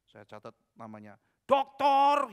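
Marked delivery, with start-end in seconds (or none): 1.5-2.3 plus aigu et plus fort, exigeant; réclamer son titre avec fierté; insistance hautaine. Retold or imitated. Pria menuntut gelar.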